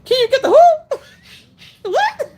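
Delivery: high, loud incredulous squawk; laughing disbelief. Retold or imitated. Server reacting to a botched order, laughing in disbelief. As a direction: crack up while asking, baffled and high. Mock shock at the mangled order.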